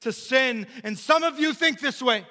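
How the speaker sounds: loud, high preacher's build; full-force preaching. Acted. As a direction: preach it out to the congregation.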